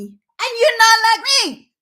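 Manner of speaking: rising, loud declaration; loud insistence. Real, not acted. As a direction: throw YOU'RE at them, full voice.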